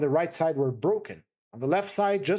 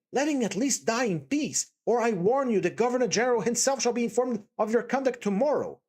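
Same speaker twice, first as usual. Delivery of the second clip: higher, urgent woman's voice; pleading Katerina; 6 semitones higher than usual. A narrator's reading. Katerina to the landlady, urgently pleading. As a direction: plead over the dying man. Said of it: "Katerina pleads to landlady".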